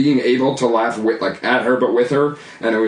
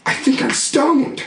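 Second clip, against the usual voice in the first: higher, slow, dazed mom voice; imitating stoned mom; 12 semitones higher, slower than usual. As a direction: slowly confess you're high. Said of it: "and she goes".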